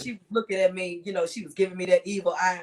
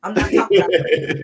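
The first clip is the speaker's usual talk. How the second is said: loud laughing denial; 11 dB louder than usual. Real, not acted. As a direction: laugh it off loudly. Laughing disclaimer.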